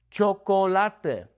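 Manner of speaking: "Chocolate" as cho-ko-LA-te, slow; Spanish chocolate. Shown as a demonstration. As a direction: stretch "Chocolate" into four Spanish syllables. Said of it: "Not chocolate".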